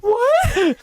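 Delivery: high, loud imitated disbelief; shocked mom. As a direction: squeak it out like a stunned mom. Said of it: "my mom at that point is just like".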